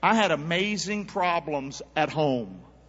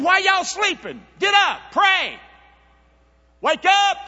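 much higher, loud shout; Jesus shouting; 12 semitones higher, 7 dB louder, slower than usual. Retold or imitated. Preacher voicing Jesus waking sleeping disciples, shouting high. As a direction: roar at them to wake up.

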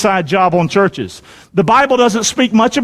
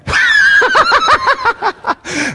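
big laugh. Real, not acted. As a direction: laugh out hard at the joke. Joking about church members.

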